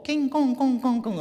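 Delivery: high, loud singsong imitation of Chinese; mock Chinese speech. Man mimicking the convert talking to his parents, loud singsong babble. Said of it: "nou praat hy met sy ma en sy pa".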